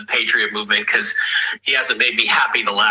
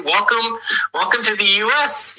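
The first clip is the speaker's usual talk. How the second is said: much higher, bright mock-ceremonial welcome; jokey welcome; 11 semitones higher than usual. Welcoming his Australian friend to the US, high playful mock welcome. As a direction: throw your arms open and welcome him in.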